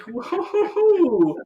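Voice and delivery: high, loud excited burst; excited whoa. Real, not acted. Host startling cohost with a listener poll, excited high burst. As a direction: jump in with a sudden eager whoa. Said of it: "I was like".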